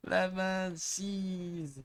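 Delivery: high-pitched mock British; silly British accent. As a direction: put on a squeaky-high British accent for fun. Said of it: "when you remove the accent".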